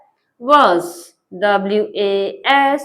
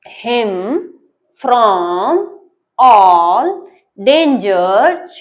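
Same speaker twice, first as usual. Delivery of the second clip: each word said alone with long gaps, evenly; slow word by word; much slower than usual. Shown as a demonstration. Teacher reading the sentence slowly for students, word by word. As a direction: read slowly, one word at a time. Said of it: "आराम से पढ़ेंगे".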